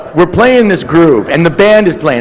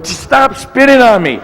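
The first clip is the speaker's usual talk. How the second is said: mock complaint; 7 semitones higher, slower than usual. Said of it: "didn't know him well enough to go".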